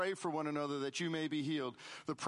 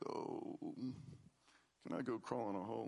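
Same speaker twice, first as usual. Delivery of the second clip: embarrassed murmur; 6 semitones lower, 8 dB quieter than usual. Retold or imitated. Embarrassed inner thought retold.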